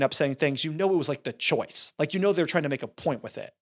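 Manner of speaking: ordinary talk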